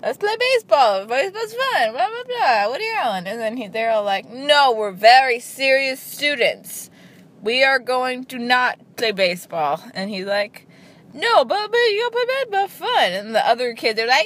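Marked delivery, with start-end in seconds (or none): 0.0-3.3 much higher, eager mock voice; mock Woody Allen. Vlogger mocks Woody Allen pushing baseball on kids, high and eager. 4.3-9.8 slow, stiff mock-serious kids; mechanical, every word deliberate; robotic serious kids. Vlogger mocks precocious kids refusing baseball, slow and robotic. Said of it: "they're like robots". 11.1-13.1 much higher, whiny mock plea; mock Woody Allen pleading. Vlogger mocks Woody Allen begging kids to play, high and whiny.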